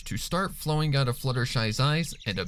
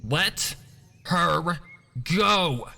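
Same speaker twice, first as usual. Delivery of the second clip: shout; 7 semitones higher, much slower than usual. Acted. Scootaloo, glass shard in hoof, shouts at Fluttershy. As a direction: punch each word harder. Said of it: "she shouted".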